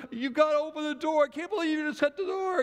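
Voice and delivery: high, loud frantic plea; panicked pleading. Retold or imitated. Trapped daughter pleading with sisters, high and frantic. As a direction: beg them through the door to open it. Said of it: "the voice inside's like".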